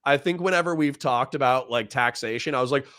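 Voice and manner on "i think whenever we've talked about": normal talking voice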